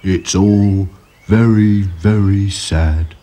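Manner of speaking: very slow, mournful drawl; gloomy. A narrator's reading. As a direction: droop through every word. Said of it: "said Sad Sack".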